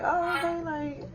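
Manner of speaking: high, soft, skeptical; mock unconvinced okay. Hearing Susie's excuse, soft skeptical okay. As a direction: sell the dubious acceptance. Quoting own reaction.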